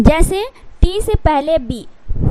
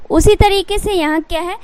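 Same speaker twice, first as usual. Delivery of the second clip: normal talking voice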